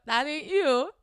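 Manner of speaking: mock disbelief at his fake voice; incredulous. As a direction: call him out, stunned.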